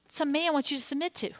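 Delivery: ordinary delivery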